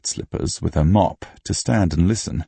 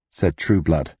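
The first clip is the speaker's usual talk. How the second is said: ordinary delivery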